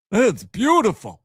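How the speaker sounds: put-on high, slow, drawn-out movie accent; barber character impression. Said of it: "the Eddie Murphy character from The Barber".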